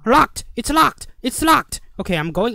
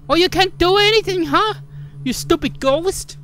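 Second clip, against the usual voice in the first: taunting; 8 semitones higher than usual. Real, not acted. Taunting the game ghost.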